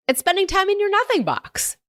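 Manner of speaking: put-on very high-pitched, telling a kid; high voice held up, then dropping at the end; retold chirpy suggestion. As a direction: pitch it way up, as if to a child. Retelling own answer to bored kid.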